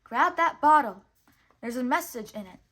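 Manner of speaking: loud call that settles down. A narrator's reading. The cricket telling Pinocchio to grab the bottle, loud call. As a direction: call out the instruction, then ease off. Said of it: "called the cricket".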